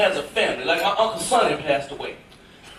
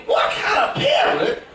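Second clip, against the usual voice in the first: high, slow, outraged disbelief; slow scandalized squeal at the parent; mock outraged thought; 6 semitones higher, slower than usual. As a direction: play up the judgmental inner voice. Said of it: "in my mind, I'm thinking".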